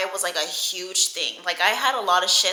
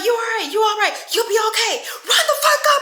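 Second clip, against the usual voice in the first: much higher, louder mock reassurance then challenge; drunk aunt, hammed-up; 14 semitones higher, 4 dB louder, faster than usual. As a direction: toss a quick check then go back to fighting. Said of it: "She's like".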